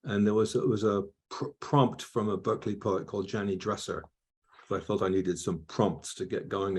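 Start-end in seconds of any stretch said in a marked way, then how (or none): none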